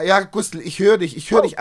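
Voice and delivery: ordinary talk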